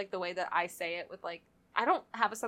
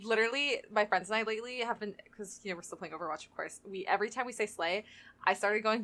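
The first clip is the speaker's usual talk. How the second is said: ordinary delivery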